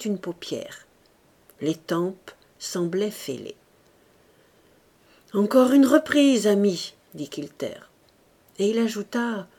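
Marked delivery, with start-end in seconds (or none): 5.3-6.9 raised, loud encouragement that eases on the last word; rally a flagging fighter; urging on. A narrator's reading. Second urging on his boxer. (encouragement lancé d'une voix forte)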